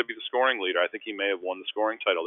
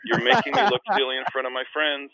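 high, loud mock outrage; indignant protest; 7 semitones higher, 8 dB louder than usual. Embarrassed in front of friends, indignant at the prankster. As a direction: protest loudly, pitched way up.

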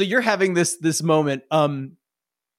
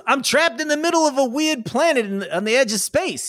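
pitched up, exasperated NPC; high NPC voice; 9 semitones higher than usual. Acted. GM voicing a stranded NPC, high and exasperated. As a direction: squeak it out, baffled at the question. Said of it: "He goes".

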